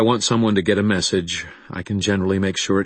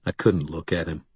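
ordinary delivery, nothing marked

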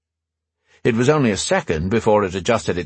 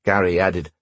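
ordinary delivery, nothing marked

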